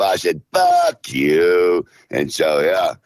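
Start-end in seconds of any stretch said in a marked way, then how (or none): 0.5-1.9 a retold shout back at the aunt; retold shouted curse. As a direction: throw it back at her harder, half shouting. Retold swearing fight with aunt.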